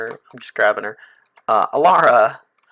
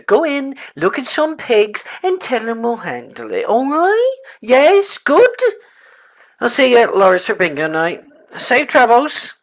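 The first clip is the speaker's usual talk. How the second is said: NPC voice; 6 semitones higher than usual. Acted. NPC voiced in game scene.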